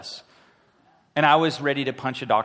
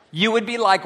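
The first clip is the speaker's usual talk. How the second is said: usual speaking voice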